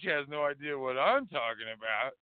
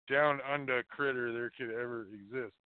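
ordinary delivery

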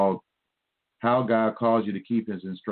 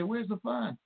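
high, softer imitation of a complaining baby; pretend-crying complaint, pitched up; mock crybaby; 13 semitones higher, 6 dB quieter, slower than usual. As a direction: mimic a childish whine. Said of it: "you cry like a baby".

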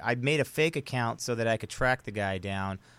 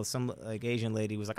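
usual speaking voice

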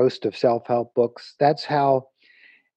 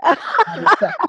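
laughing break; 13 semitones higher, slower than usual. Real, not acted. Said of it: "laughs mid-title".

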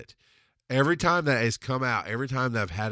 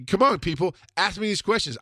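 higher, louder eager urging; rally the audience to ask; excited plea; 9 semitones higher, 4 dB louder than usual. Real, not acted.

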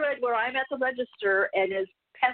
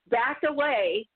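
ordinary talk

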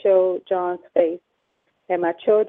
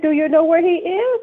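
higher, louder exasperated question; exasperated demand; 9 semitones higher, 7 dB louder than usual. Retold or imitated. Pressing the officers who offer protection, exasperated and loud. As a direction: push back at the police, fed up. Angry retort to police.

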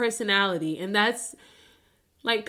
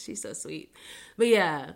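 ordinary delivery

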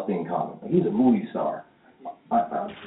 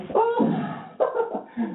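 high mock giggle. Mom laughs in acted scene.

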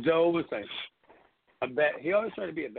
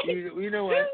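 high, cracking voice on the question; the voice breaks while quoting him; voice breaking; 13 semitones higher than usual. Real, not acted. Recalling friend, voice breaks.

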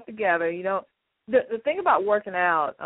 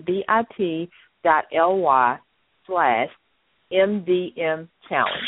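spelling the web address slowly; slow, clear repeat; much slower than usual. Shown as a demonstration. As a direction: read the link out slowly. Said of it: "So again".

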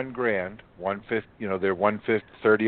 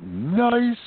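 loud, high, swooping nice; re-enacted burst of delight; delighted approval; 12 semitones higher, 5 dB louder than usual. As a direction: let it soar up with relish.

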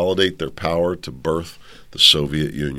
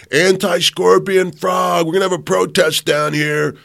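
louder, higher, indignant scorpion voice; ranting scorpion; 7 semitones higher, 5 dB louder than usual. Acted. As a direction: harangue the frog as an aggrieved scorpion. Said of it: "So the scorpion comes along and says".

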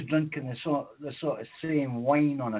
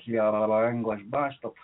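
usual speaking voice

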